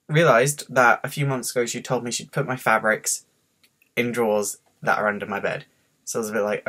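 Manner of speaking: ordinary talk, nothing marked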